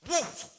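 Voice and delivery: loud, high burst of a flare-up; explosive "woof" of a lighter igniting; sudden sound-effect exclamation; imitated flame whoosh.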